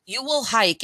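plain reading voice

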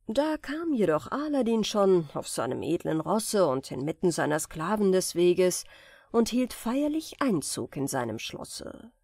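usual speaking voice

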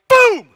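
sudden loud "boom"; imitated crash. Preacher mimics the crash that ended his memory, sudden loud boom. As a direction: burst out a booming crash noise.

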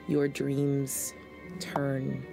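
ordinary talk, nothing marked

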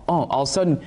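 normal talking voice

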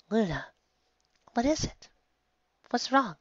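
soft worried big sister; worried, gentle. Acted.

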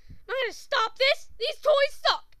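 high, loud, defiant yell; child's tantrum. Acted. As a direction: refuse like a bratty kid. Child's tantrum over toys.

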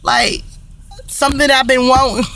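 ordinary talk

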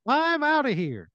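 very high, slow put-on voice; silly high-pitched exit line; Kevin Heaven. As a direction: call it out high and drawn out. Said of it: "And Kevin Heaven goes".

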